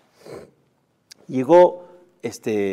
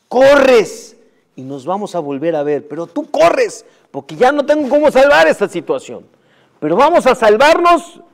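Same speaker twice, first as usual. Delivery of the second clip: urgent plea; 6 semitones higher, 8 dB louder than usual. Acted. Father pleading urgently.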